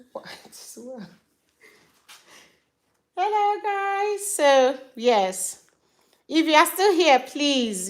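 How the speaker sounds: ordinary talk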